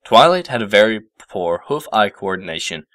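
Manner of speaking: ordinary delivery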